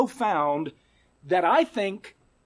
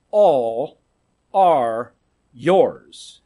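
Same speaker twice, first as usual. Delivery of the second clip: "all", "are", "yours" each set apart with pauses and lower; word by word; 8 semitones lower, 4 dB louder, much slower than usual. Real, not acted. Preacher spelling out the verse slowly to the church. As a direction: space out every word. Preacher spaces scripture words.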